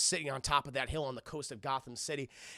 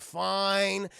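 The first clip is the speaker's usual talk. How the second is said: louder, higher exasperated "fine"; grudging agreement; 5 semitones higher, 5 dB louder than usual. Acted. Little brother told to get the door, exasperated. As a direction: give in with mock irritation.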